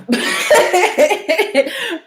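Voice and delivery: high, drawn-out giggling laugh. Real, not acted. Woman laughing at her own blunt remark, laughing.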